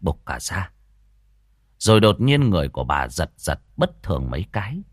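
lively village ghost-story telling; storyteller voice for a spooky folk tale; story narration. A narrator's reading. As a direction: draw the listener into the scene.

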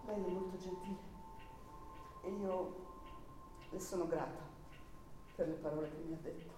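soft, weary dismissal; congedo stanco. Acted. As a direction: let her pull away gently, tired. Lo congeda con calma triste. (miss Buseman sending K away, sad and soft)

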